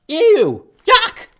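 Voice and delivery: high, loud squeals of disgust; gosling disgust. Acted. Goslings rejecting the food Bruce offers, disgusted. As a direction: spit out the food like picky chicks.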